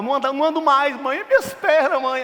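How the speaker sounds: high, hammed-up wail to mum; boy faking injury. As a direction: play up a fake injury, pitched high and loud.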